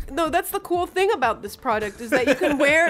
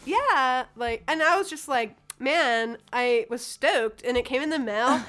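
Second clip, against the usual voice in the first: normal talking voice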